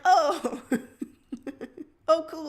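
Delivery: loud, laughing "oh, cool" with a high pitch; laughing realization. Retold or imitated. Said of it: "I'm like".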